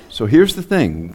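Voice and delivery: ordinary delivery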